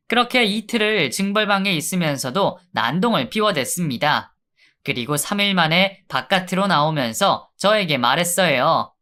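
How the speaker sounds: plain reading voice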